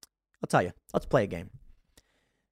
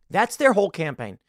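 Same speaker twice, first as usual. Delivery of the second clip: ordinary delivery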